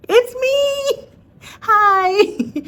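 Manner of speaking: very high, loud singsong hello; cutesy greeting. Acted. Pesky patient greeting her doctor, high singsong. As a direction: chirp it like a pest popping up.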